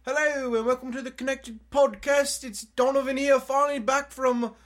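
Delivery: put-on Australian accent. Host starts another intro take, drifting into Australian accent. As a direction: open the show in a mock Australian accent. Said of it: "We've already migrated to Australian".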